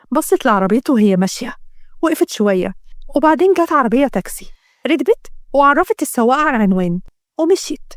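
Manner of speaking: ordinary delivery, nothing marked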